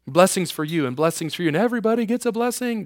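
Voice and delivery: soft, hushed giveaway gush; a light mock talk-show host handing out gifts; Oprah impression. Lightly imitating a talk show host showering the audience, hushed. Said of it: "God's not Oprah".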